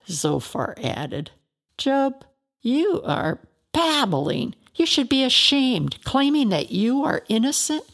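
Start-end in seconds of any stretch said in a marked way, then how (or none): 1.8-7.8 scolding rebuke. Acted. Zophar scolds Job.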